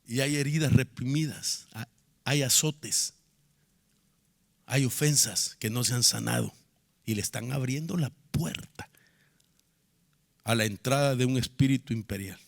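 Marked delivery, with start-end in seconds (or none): 4.7-8.9 whisper. Real, not acted. Sermon, voice drops.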